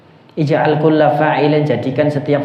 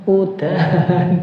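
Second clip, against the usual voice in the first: Javanese udan; 6 semitones higher, much slower than usual. Retold or imitated. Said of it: "Kalau kita kan ada hujan".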